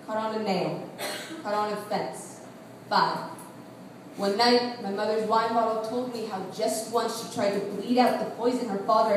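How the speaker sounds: subdued, measured poetry recitation; spoken-word poem. Acted. Poet confessing hidden scars to an audience, subdued spoken-word delivery. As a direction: confide it quietly, line by measured line.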